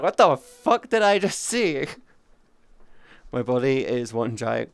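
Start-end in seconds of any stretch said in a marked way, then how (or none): 0.0-1.9 high, loud stunned yelp; shocked mock outburst; stunned disbelief. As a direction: react like you can't believe your eyes. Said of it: "you just sit there going like".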